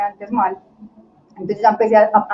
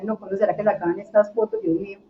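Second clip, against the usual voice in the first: softer, retold private worry; troubled inner thought; 8 dB quieter than usual. Model fretting inwardly during a photo shoot, quiet troubled thought. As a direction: think it to yourself, quietly uneasy. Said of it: "más contrariada en mi corazón".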